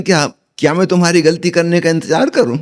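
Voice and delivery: acted exasperation for a joke; mock indignant retort. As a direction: argue back with playful indignation. Joke punchline, Nasruddin's indignant retort.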